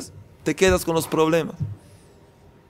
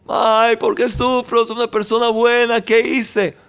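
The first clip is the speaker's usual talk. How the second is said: higher-pitched imitation of a wronged sufferer; mock self-pitying complaint; 6 semitones higher than usual. Preacher mimicking someone who asks why they suffer, mock plaintive. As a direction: play a put-upon complainer in a raised voice.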